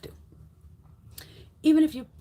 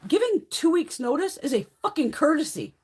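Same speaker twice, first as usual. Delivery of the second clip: normal talking voice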